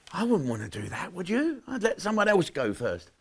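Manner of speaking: usual speaking voice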